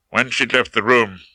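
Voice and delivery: ordinary delivery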